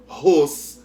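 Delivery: Ros punched loud by itself; loud single-syllable Ros as the answer; stressed syllable alone. Shown as a demonstration. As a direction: isolate Ros, loud and firm. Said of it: "que pronunciou com mais força".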